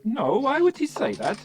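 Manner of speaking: playful fake Latvian accent. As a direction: deny it in a put-on Latvian accent. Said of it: "And I said".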